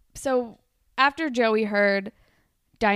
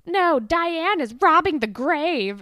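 high, slow, loud mock gossip; imitating a gleefully mean friend; shitty friends; 7 semitones higher, 6 dB louder, slower than usual. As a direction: drag out the catty put-down. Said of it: "being assholes about him".

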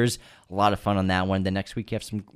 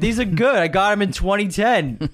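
high, loud indignant Boston guy; outraged Boston accent, pitched up; 10 semitones higher, 5 dB louder than usual. Pretend. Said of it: "Boston Aladdin".

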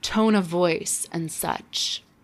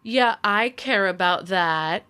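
slow, exaggerated nineties sarcasm; mock-agreeing, drawn-out and dismissive; sarcastic; slower than usual. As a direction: lay the sarcasm on thick, stretching the words. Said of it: "Sarcasm is so nineties, being like".

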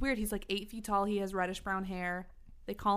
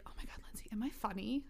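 imitating a hushed, needy question; insecure whisper; 10 dB quieter than usual. Imitating friend coming over alone, insecure whisper. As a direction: whisper it timidly, fishing for reassurance.

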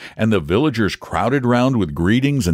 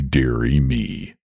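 lower, slower, sinking moan; 7 semitones lower, slower than usual. A narrator's reading. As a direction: let the second dearie drag and sag. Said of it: "moaned the dragon".

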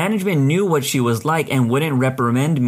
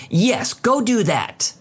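slow, high and eager; enthusiastic; 7 semitones higher, much slower than usual. Retold or imitated. Customer eagerly sending the clerk to fetch the catalog. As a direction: urge her on, sure of winning. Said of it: "she says enthusiastically".

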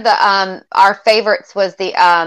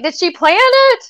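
high excited quote; 14 semitones higher than usual. Retold or imitated. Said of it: "I'm always like".

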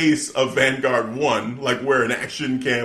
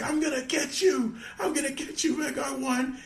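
much higher, softer playful threat; cartoon villain vow; mock threat; 14 semitones higher, 5 dB quieter than usual. Host imitating Jericho threatening the drone, mock menace.

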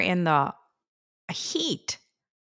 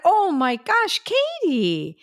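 high, re-enacted gasp of amazement; delighted surprise; 10 semitones higher, slower than usual. Mother seeing her daughter walk in unexpectedly, astonished. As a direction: relive the astonished greeting, pitched way up.

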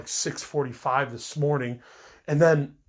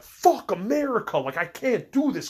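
retold angry outburst; 8 semitones higher than usual. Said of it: "I'm just pissed off".

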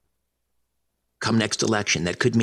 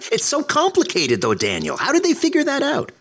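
mock amazement, pitched way up; sarcastic. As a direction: play dumb, overly impressed. Sarcastic mock amazement at simple solution.

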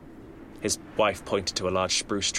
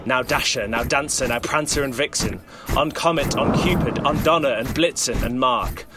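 loud rising roll call; rally the reindeer, louder each name; 5 semitones higher, 4 dB louder than usual. Acted. Santa summoning his reindeer to attack, rising loud call.

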